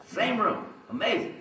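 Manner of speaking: high, marvelling exclamation; high-pitched delighted wonder; amazed emphasis. Real, not acted. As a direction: savour the irony. Marvelling at the coincidence.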